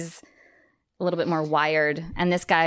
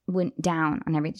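usual speaking voice

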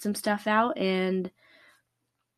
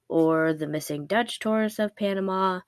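usual speaking voice

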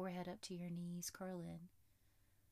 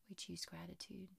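soft spa whisper; 7 dB quieter than usual. Acted. Whispered affirmation.

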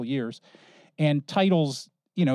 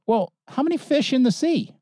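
put-on higher, eager little boy; a hint of a curious kid; lightly voiced child; 10 semitones higher than usual. Boy voiced in retold joke.

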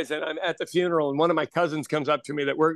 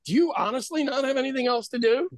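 honest disbelief; 7 semitones higher, faster than usual. Retold or imitated. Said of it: "We're saying it like".

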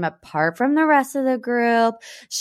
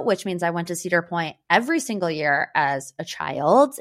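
ordinary delivery

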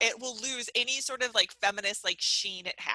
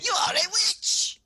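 put-on hammy French accent, high and loud; 10 semitones higher, 5 dB louder than usual. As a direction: cry it out in a bad French accent. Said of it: "if they had tried it with French accents".

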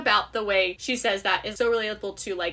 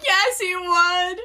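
slow, louder mock-crying swoon; fake sob; 7 semitones higher, 4 dB louder, much slower than usual. Real, not acted. Friend pretending to cry over the romance. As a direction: sob it out with joy.

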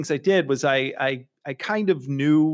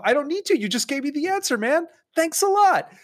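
high, bright fake thanks; mock gratitude; 13 semitones higher than usual. Brushing off the recruiter with high mock thanks. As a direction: gush high and sarcastic. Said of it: "And I go".

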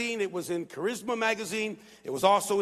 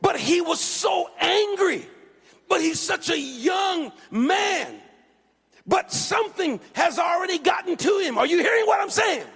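raised, high-pitched sermon delivery; fervent preaching; 5 semitones higher than usual. Real, not acted. Preacher laments an angry young man to congregation, fervent preaching. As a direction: preach it with urgent, driving force.

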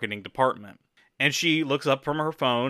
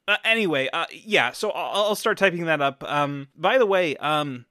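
higher, chirpy assistant voice; high Dale voice; 5 semitones higher than usual. Acted. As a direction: pipe in brightly, higher. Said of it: "Dale pipes in and says".

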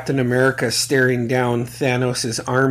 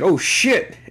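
retold exclamation; 6 semitones higher than usual. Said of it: "I out loud said".

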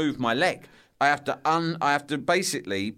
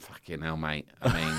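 low muttered friend; 9 semitones lower, 6 dB quieter than usual. Retold or imitated. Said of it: "he just shrugged like".

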